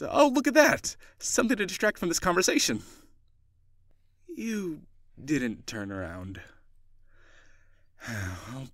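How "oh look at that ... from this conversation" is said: high, loud mock surprise; hammed-up gasp of discovery, pitched way up; fake distraction. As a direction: point away high and loud to dodge the question. Faking a distraction to dodge question.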